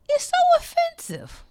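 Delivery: high, loud offended cry; preacher outraged at the congregation; outraged preaching. Acted. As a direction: hurl it out in outrage. Preacher at full force.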